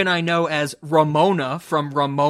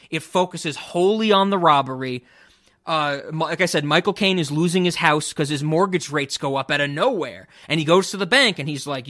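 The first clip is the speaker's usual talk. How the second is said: usual speaking voice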